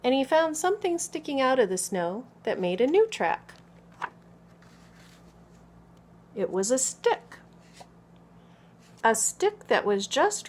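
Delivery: put-on expressive picture-book narration for small children; story read-aloud. A narrator's reading. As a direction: read clearly and with lively emphasis to children.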